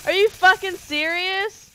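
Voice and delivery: loud, high, slow disbelieving shout; outraged yell. Real, not acted. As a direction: hammer every word in real outrage. Outraged after losing in game.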